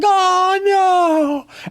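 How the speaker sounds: cartoon oh no; high mock dismay. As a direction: player realising he rolled too high. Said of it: "He's like".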